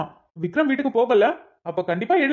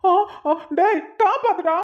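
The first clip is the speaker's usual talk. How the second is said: panicked cry for help; 8 semitones higher, slower than usual. Acted. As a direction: squeal and beg a friend to save you. Man being beaten, begging help.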